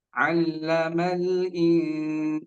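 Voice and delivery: slow Allamal in- holding the nun; held in. Shown as a demonstration. Teacher shows the held nun. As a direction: pause and hold the in. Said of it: "jadi tahan dulu sebentar".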